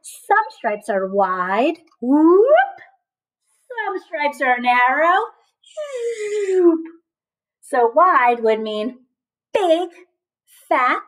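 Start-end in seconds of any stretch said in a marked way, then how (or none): 2.0-2.4 bright silly whoop; a fun sound for wide stripes; playful whoop. Pretend. Teacher making a silly sound for wide stripes. 6.5-6.8 high silly zoop; a quick fun noise for skinny stripes; playful zoop. Pretend. Teacher making a silly sound for narrow stripes.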